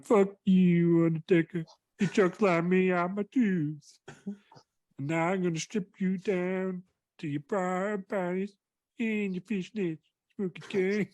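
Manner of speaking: high, drawn-out comic threat voice; hammed-up high-pitched taunt; taunting wrestler. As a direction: threaten slowly in a silly high voice. Acted wrestler's threat, high slow voice.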